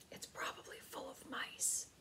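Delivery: hushed, unvoiced aside; whispered protest. Mother fretting about mice to her husband, whispered. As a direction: whisper the worry so Matt won't hear. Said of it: "made one last protest".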